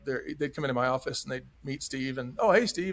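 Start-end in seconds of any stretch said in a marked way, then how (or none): 2.4-2.9 higher, louder imitation of eager staff; excited greeting. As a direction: mimic excited employees greeting Steve. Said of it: "And they were all excited".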